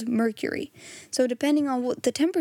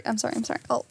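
ordinary delivery

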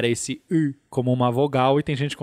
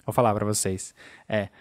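ordinary talk, nothing marked

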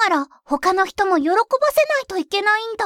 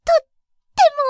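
とっても said higher and louder; excited emphasis; 6 semitones higher, 5 dB louder, faster than usual. Acted. Child to mother about the big house, excited.

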